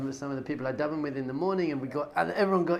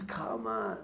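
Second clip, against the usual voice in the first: scoffing whisper; 8 semitones lower, 6 dB quieter than usual. Real, not acted. Dismissive aside.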